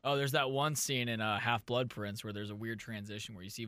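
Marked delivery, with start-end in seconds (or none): none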